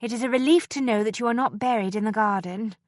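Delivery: ordinary delivery, nothing marked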